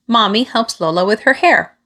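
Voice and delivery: ordinary delivery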